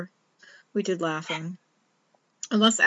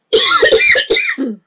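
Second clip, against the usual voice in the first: hoarse throat-clearing cough; rough cough; 9 dB louder than usual. Real, not acted. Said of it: "I'm losing my voice a little bit here".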